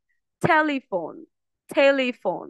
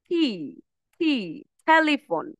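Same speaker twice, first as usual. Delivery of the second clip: usual speaking voice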